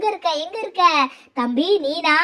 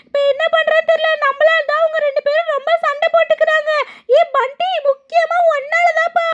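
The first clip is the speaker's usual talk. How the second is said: high anxious put-on voice, partly fast; higher voice blaming the friend for the ghost fight; worried figure; 12 semitones higher, 4 dB louder than usual. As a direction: fret in a high character voice.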